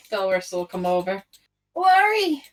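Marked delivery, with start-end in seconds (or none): 1.7-2.4 loud call across the field; shouting. Acted. Delris calls out to Laurie.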